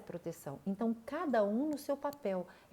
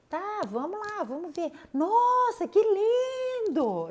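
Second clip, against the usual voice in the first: much higher and louder, the final words drawn out slowly; exaggerated delight; 11 semitones higher, 7 dB louder, slower than usual. Shown as a demonstration. Grandmother praising her grandson's drawing with hammed-up delight. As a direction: gush with wide-eyed wonder over a drawing. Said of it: "Eu vou falar bem assim".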